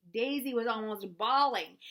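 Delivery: plain reading voice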